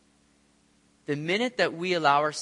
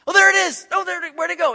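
high, loud "oh, there it is" then lost; excited then puzzled; startled spotting; 13 semitones higher, 10 dB louder than usual. Retold or imitated. Disciple spotting the figure then losing it, startled.

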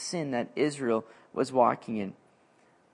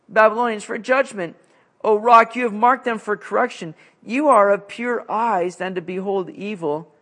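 higher, louder pleading prayer; impassioned scripture reading; 4 semitones higher, 4 dB louder than usual. Acted. Preacher reading Habakkuk's prayer, impassioned and raised. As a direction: read it as a cry to God.

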